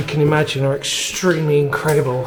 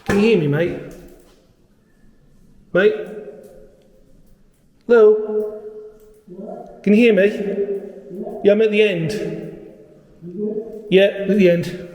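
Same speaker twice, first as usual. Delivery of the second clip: calling out; 6 semitones higher than usual. Real, not acted. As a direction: shout to a friend far away. Calling out to Paul down tunnel.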